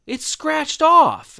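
gasp, then a high slow amazed cry; shocked gasp. Retold or imitated. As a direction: gasp in disbelief and squeal it out. Teacher amazed at missing serial.

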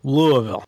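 "Louisville" slurred as "Loo-a-vull"; spills out of mouth. Shown as a demonstration. Panelist demonstrates local "Louisville", loose "Loo-a-vull". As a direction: let "Louisville" spill out, middle swallowed. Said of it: "it just kinda spells out of your mouth".

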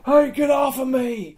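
high, slow yell at the attacker; desperate shout. Pretend. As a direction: fight him off with your voice. Demon stabbing him repeatedly.

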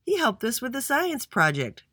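high, eager testimony; voicing up. A narrator's reading. Giovanni vouching for Andy at the meeting, voicing up. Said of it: "voiced Giovanni".

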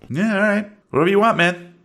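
high-voiced easygoing pal; Bugsy. Driver agrees to help Roy, high-pitched buddy voice. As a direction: go along with it in a high voice. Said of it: "Bugsy is idling in the U-Haul".

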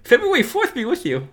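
high lisping Tyson voice; Mike Tyson impression. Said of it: "You think Mike Tyson".

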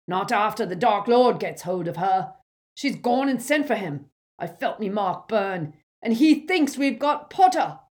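ranting. A narrator's reading. Amycus ranting.

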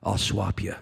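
low-pitched, understated reply; Jesus, calm assurance. Acted. Jesus answering the sinner about the robes, calm and low. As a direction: answer low and unhurried, already decided. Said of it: "He says".

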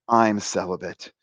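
low, soft, calm statement; solemn priest. Retold or imitated. Priest repeating himself to agitated teenager, quietly solemn.

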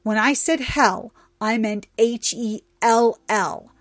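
loud, emphatic protesting; frantic denial. A narrator's reading. Trixie explains herself to an angry goddess, protesting loudly. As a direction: insist forcefully, punching the words.

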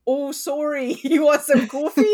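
high, loud mock-Canadian apology; Canadian accent. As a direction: overdo a morning Canadian accent. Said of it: "his accent was so Canadian".

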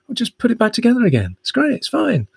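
high, quick soothing parent voice; breezy reassurance to a child; reassuring parent. Retold or imitated. Host as parent soothing kids over Lego, high breezy.

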